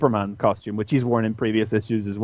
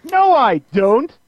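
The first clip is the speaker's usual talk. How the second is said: put-on high, loud, slow girlish protest; denial; 12 semitones higher, 5 dB louder, slower than usual. Terra denying she likes Beast Boy, high and loud. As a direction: deny it like a flustered kid. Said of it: "She turns around and goes".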